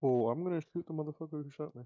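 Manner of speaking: normal talking voice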